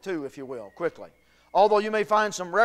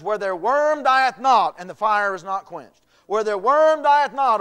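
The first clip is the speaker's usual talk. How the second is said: emphatic scripture reading; 3 semitones higher, 8 dB louder than usual. Acted. Preacher quotes scripture forcefully.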